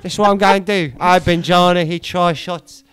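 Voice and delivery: imitating Harry Redknapp. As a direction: spin it like a chatty old manager. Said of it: "I love Cockney storytellers".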